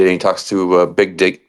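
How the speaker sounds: usual speaking voice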